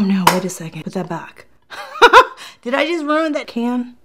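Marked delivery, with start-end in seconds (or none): 1.7-2.6 high, loud cackle; burst of laughter. Real, not acted. Laughs at spoiling the can. 2.6-4.0 high-pitched question through laughter; laughing. Real, not acted. Laughing at own mishap.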